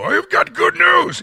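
battered cheerful Paul. Retold or imitated. Worn-out Paul bursting in with news, manic cheer. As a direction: crow it like a wrecked but happy man. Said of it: "dragging himself in to go".

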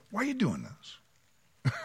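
slow, soft, kindly asked; gracious gentle question. Retold or imitated. Gracious God asking Saul gently, soft and slow. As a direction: ask it gently, like a hurt friend. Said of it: "how incredibly gracious for him to go".

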